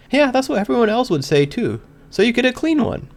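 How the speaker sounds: a hint of Pinkie's bouncy lilt; lightly voiced Pinkie. A narrator's reading.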